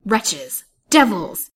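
two loud bursts, rising in force; passionate shout. A narrator's reading. Colville shouting at the would-be murderers, enraged. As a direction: hurl out both words in fury. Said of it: "he shouted, in a voice hoarse with passion".

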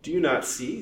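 high, pointed question. A narrator's reading. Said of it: "said Ada pointedly".

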